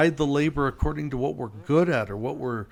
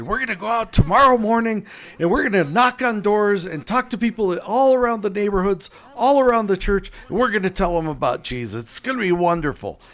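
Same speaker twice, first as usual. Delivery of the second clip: higher and louder, gushing with excitement; over-the-top enthusiasm; 8 semitones higher, 4 dB louder than usual. Retold or imitated. Team captain pitching door-knocking, over-the-top enthusiasm. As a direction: play the eager captain selling the plan. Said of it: "super enthusiastic and almost over the top".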